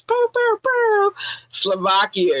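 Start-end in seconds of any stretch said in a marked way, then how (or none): 0.0-1.1 high mock horn noise "bow"; horn blasts. Celebrating Jamaica with mock horn blasts. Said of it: "I need my horns".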